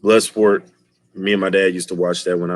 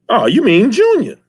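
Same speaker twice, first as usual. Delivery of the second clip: high, loud taunting question; mock sidekick; 13 semitones higher, 7 dB louder than usual. Henchman mocking the son's name, high and loud. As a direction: smirk it at the dad.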